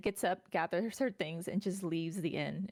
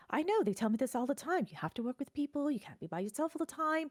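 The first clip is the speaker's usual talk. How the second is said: higher, sing-songy parody of a nagging voice; mocking singsong; 8 semitones higher, faster than usual. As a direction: parrot the advice you're sick of hearing. Mage mocking others' advice.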